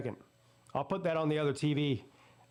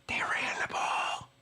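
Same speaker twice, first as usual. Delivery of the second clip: emphatic stage whisper; slower than usual. Real, not acted. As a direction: whisper it slowly like a stunning revelation. Savouring the vindicating running play.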